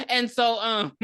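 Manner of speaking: ordinary talk, nothing marked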